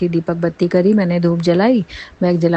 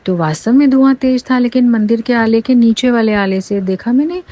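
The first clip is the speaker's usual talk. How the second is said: higher, urgent excited telling; alarmed retelling; 5 semitones higher, 3 dB louder than usual. Real, not acted. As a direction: relive the shock of the fire. Discovering the fire.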